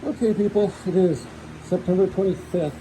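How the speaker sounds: muffled through a mask; talking through a face mask; through fencing mask. Real, not acted. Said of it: "I got my mask on".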